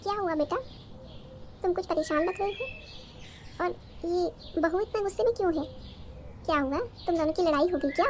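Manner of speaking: a sweet, concerned mother checking on her son; doting mother-in-law. Acted. (हल्के से बदली आवाज़ में चिंतित सास)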